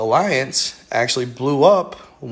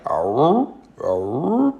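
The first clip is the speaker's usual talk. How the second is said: giant moaning. Retold or imitated. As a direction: moan like a buried giant. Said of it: "Pretty good impression".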